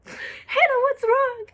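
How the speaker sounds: high, sugary fake concern; mock fake sympathy. Popular girls pretending to care, mock sympathy. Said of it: "wanna suck the fart out".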